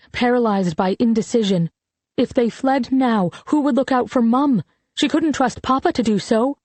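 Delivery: ordinary delivery, nothing marked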